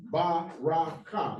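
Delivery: ba, ra, ka in separate open syllables. Shown as a demonstration. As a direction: sound out "ba, ra, ka", each ending on its vowel. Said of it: "All those are open syllables".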